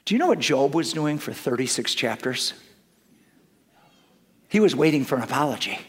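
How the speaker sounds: ordinary talk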